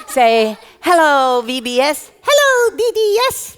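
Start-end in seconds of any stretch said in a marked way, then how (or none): 2.2-3.6 put-on high-pitched childlike puppet voice; excitable little puppet, way up high; puppet character. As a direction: squeak it out loudly, muddling the consonants. Puppet echoes the host.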